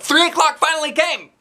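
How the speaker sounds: high thrilled storytelling; excited narration. A narrator's reading. Child narrator announcing arrival, high and thrilled. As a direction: burst with news.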